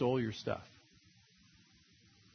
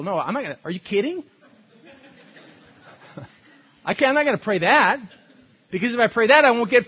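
louder, higher, hammed-up protest; mock indignant refusal; 7 semitones higher, 9 dB louder, faster than usual. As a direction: play the horrified believer who won't pray it. Voicing a reluctant pray-er.

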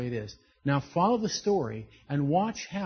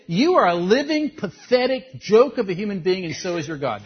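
contemptuous Pharaoh; 3 semitones higher, 8 dB louder than usual. Retold or imitated. Pharaoh sneering at Moses and his God, loud. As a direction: spit the insult at him in mock fury. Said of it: "He said".

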